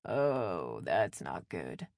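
low, muffled from another room. A narrator's reading. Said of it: "a muffled".

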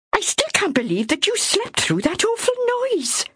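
high, reproachful, trailing off; admonishing. Acted. Myra scolding Ethel, high reproach. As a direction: scold her for sleeping through it. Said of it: "Myra admonished".

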